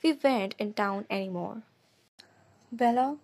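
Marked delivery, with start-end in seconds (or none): 2.7-3.2 a questioning Bella? with a tight, held-in rise; tightly controlled question. A narrator's reading. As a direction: ask Bella? while holding anger back. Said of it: "his voice tight, controlled".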